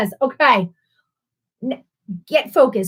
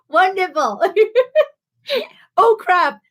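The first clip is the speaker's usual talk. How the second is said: laughing; 6 semitones higher than usual. Real, not acted. Host cracks up over question.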